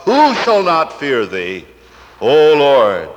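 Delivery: loud, slow cry of worship. Acted. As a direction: proclaim it to God at full volume. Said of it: "cry out".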